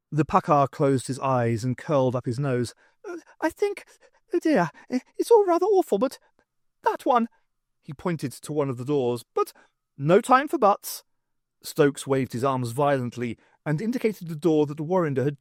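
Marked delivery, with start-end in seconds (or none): 3.0-7.3 much higher, fussy, flustered voice; a fretting old rodent academic; dithering professor. A narrator's reading. Warrender choosing the escape door, high and flustered. 9.3-11.0 higher, brisk urgent words; urgent brush-off. A narrator's reading. Stokes hurrying the professors out, higher and urgent. Urging the others.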